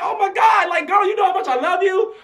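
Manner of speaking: high-pitched acted fangirl squeal; starstruck gushing. Photographer silently adoring smiling [name], starstruck squeal. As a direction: gush at your idol, voice way up.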